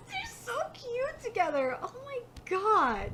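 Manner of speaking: high coo sliding downward; gushing. Real, not acted. Reader swooning over the pair being cute, gushing. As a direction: melt over how adorable they are.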